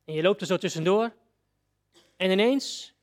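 ordinary delivery

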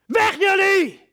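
furious high shout, driving them out; a sudden, explosive cry of rage; angry shout. Acted. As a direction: bellow at the traders to get out. Jesus drives traders from temple.

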